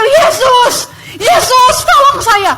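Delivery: high, loud cry for help; retold screaming. Reliving her plea on the street, screaming. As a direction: shout desperately, far up in pitch. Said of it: "Teriak-teriak".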